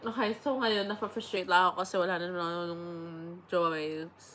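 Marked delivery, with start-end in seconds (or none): none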